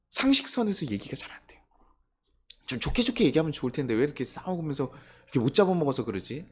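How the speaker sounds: normal talking voice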